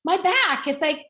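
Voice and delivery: high-pitched, fast, exasperated retold snap; snapped. Mother retelling how she snapped at her daughter, high irritated snap. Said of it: "I kinda snapped at her".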